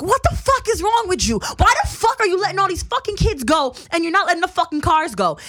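high, loud outraged retold yell; screaming at her. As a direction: reenact screaming at someone, full force. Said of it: "I'm, like, screaming at her".

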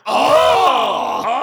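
high, loud mock moan; sex moan. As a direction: cry it out like a hammy climax. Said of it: "during sex you don't go".

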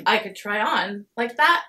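getting emotional. Real, not acted. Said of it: "you're getting a little emotional".

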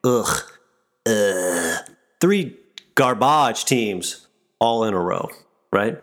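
ordinary delivery

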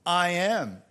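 loud, booming proclamation; retold divine pronouncement, strongly projected; powerful declaration. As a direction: declare it with full authority. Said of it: "this power came out when he said".

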